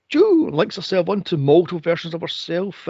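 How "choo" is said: mock teleport sound. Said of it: "then she goes".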